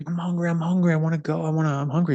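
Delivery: high-pitched imitation of a whining kid; child's tantrum. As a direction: mimic a hungry child throwing a fit. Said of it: "throwing an absolute fit".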